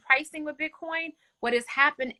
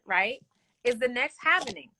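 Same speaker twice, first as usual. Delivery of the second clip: ordinary delivery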